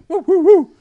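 loud, high monkey whooping; monkey hoots. Acted. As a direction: imitate an excited monkey's hoots. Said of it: "Mister Monkey came running forward".

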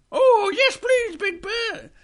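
high, loud little bear, slow on please; eager small bear begging. Acted. Dad voices Little Bear.

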